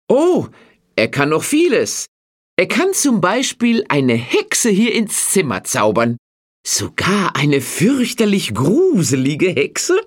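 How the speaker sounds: lightly voiced cheeky boy; playful boast. A narrator's reading. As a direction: tease the farmer with a sly boast. Klaus playfully boasting to farmer.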